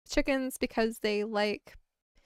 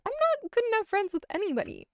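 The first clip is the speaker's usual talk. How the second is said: whiny high voice; 10 semitones higher than usual. Acted.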